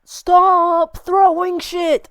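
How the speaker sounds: a genuine exasperated yell; real shout. Real, not acted. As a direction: shout at them to stop. Yelling at rioters in clip.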